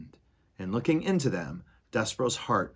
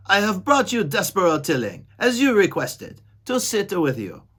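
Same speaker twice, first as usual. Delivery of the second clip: loud, high formal announcement; Furlow shouting; 10 semitones higher, 7 dB louder than usual. Acted. Furlow addressing the Mouse Council. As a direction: proclaim it to the whole council. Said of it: "shouted Furlow".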